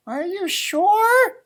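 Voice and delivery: high, slow little rabbit voice; a timid rabbit asking, drawn out; Rabbit's voice. Acted. Reading to a child, high slow Rabbit voice.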